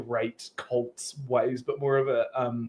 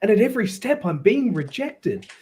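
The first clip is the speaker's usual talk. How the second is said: aggrieved adventurer in character; 6 semitones higher, 3 dB louder than usual. Acted.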